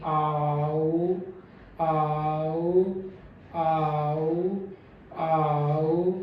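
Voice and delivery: आ with relaxed lips, then rounding into आओ; relaxed-lip demo. Shown as a demonstration. Teacher shows relaxed lips before rounding. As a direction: keep the lips loose until the glide. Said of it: "आओ में भी पहला जो मेरा वाल्स है".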